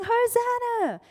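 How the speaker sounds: high, loud acclaiming cry; shouting. Retold or imitated. Crowd hailing Jesus into Jerusalem, shouting. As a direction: call it out like a cheering crowd. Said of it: "they start shouting".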